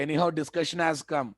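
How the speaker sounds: ordinary talk, nothing marked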